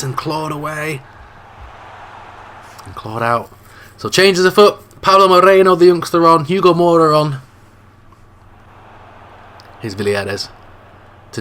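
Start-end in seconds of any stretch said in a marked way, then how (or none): 4.0-7.4 loud announcement. Acted. Substitutions announced.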